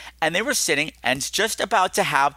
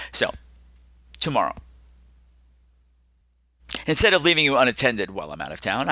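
ordinary talk

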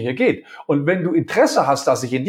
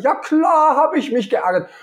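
irritated admission; 11 semitones higher than usual. Retold or imitated. Colleague admitting he was annoyed last time, irritated. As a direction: admit it with a huff.

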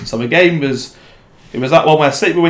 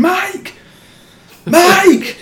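high, strained shout to a mate; imitated shout; 3 dB louder than usual. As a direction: call out like trying to catch a friend's attention. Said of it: "Just shouting, Mike".

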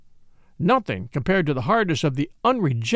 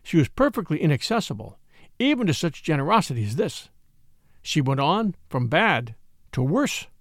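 ordinary delivery, nothing marked

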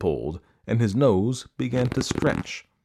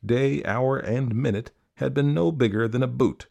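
usual speaking voice

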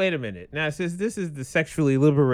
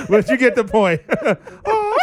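laughing; 10 semitones higher, 3 dB louder than usual. Real, not acted. Laughing fit after a joke.

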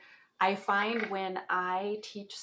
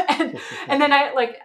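higher and louder, with a laugh; 6 semitones higher, 12 dB louder than usual. Real, not acted. Joking about sounding smart, laughing. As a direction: chuckle through the words.

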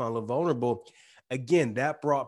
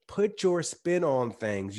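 ordinary talk, nothing marked

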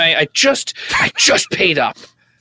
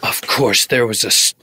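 low, measured retake; 8 semitones lower than usual. Pretend. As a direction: deliver it lower for the camera.

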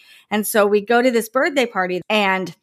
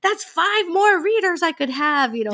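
smug inner thought; 9 semitones higher than usual. Retold or imitated.

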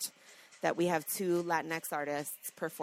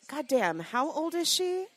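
high, astonished gasp of a question; amazed exclamation; 8 semitones higher than usual. Retold or imitated. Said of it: "And I've said".

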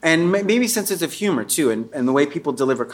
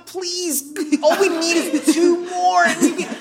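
high, slow pleading voice; eighties begging; slower than usual. Pretend. Comic imitating eighties pleading, high and slow. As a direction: beg like an eighties hustler. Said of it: "there was so much begging going on".

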